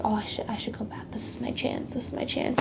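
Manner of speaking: breathy whisper of a tempting thought. Retold or imitated. Whispering to herself about going back to fight. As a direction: hush it like a secret urge to yourself.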